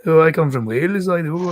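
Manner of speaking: mock a Welsh speaker, badly and for laughs; lightly put-on Welsh accent; terrible Welsh accent. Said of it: "Sorry for the terrible Welsh accent".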